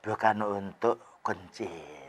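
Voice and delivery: a soft, patient correction to the Bedouin; gentle advice. Retold or imitated. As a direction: advise him tenderly, hushed and slow. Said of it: "dan dinasihati".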